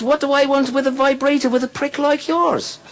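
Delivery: high falsetto wife, indignant; shrieky mock-female retort; Murphy's wife. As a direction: scoff as the wife in a high put-on voice. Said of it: "she says".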